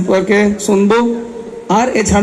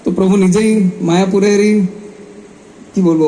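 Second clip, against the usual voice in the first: usual speaking voice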